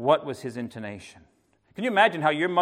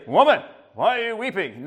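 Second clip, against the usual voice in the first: brusque; 7 semitones higher, 5 dB louder than usual. Pretend. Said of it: "He doesn't say".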